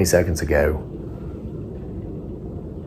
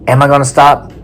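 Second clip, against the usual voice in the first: defiant; 6 semitones higher, 5 dB louder than usual. Real, not acted. Defiant rhetorical question.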